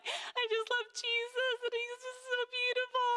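playing her younger, dreamy self; gushing naive self. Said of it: "I was just just like".

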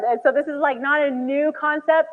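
usual speaking voice

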